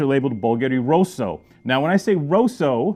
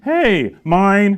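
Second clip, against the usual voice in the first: loud, higher hey, mine; claiming it; 6 semitones higher, 7 dB louder than usual. Retold or imitated. Sassicaia grabbing the other hill, playful loud claim. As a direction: call dibs on the second hill. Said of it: "Sassicaia was like".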